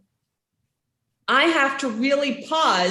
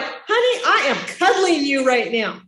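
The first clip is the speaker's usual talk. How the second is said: hurt parent voice; 3 semitones higher than usual. Shown as a demonstration. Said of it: "You're like".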